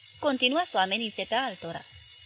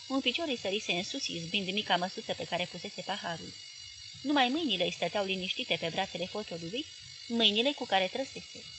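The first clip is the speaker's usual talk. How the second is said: ordinary delivery, nothing marked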